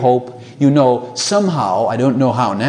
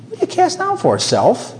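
much higher, a sudden chiding voice to oneself; David scolding himself; 12 semitones higher than usual. Acted. David rebuking his own downcast soul, high chiding voice. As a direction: snap yourself out of it, high and sharp.

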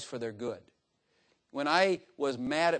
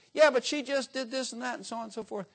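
protesting husband; 8 semitones higher than usual. Pretend. Acted husband protesting to God.

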